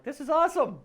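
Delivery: high, loud burst of delight; giddy, high-pitched enthusiasm; excited gushing. Acted. Acts out delight at guest's accent.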